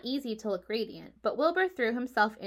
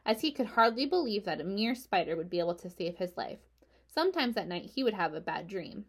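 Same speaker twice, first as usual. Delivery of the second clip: ordinary delivery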